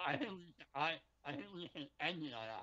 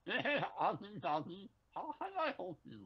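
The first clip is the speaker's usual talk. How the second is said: put-on Indian call-center accent; 2 semitones higher than usual. Co-host rehearsing a customer service greeting, Indian accent. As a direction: answer in a mock Indian accent. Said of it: "you put on an Indian accent".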